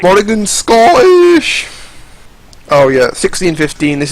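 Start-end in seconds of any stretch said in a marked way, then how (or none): 0.0-1.7 high, loud, long-drawn mock yell; mock outcry. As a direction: wail it out slowly in exaggerated outrage. Mocks Morrigan's new Scottish accent.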